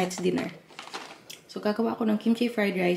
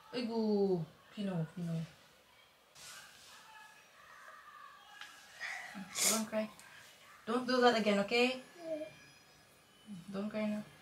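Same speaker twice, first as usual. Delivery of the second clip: softer, gentle soothing of a crying little one; soothing mother; 3 semitones higher, 6 dB quieter than usual. Real, not acted. Mother softly comforting Pino after his fall, tender and hushed. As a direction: hush him kindly so he stops crying.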